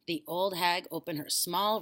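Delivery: ordinary delivery, nothing marked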